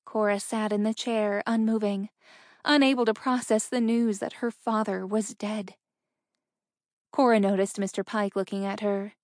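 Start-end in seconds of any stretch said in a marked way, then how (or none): none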